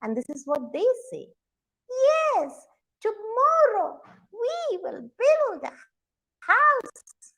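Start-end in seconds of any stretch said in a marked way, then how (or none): 1.9-7.3 very high, singsong mama monkey. Acted. Mama monkey agreeing with father monkey, high-pitched. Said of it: "mama monkeys always shake their left hand".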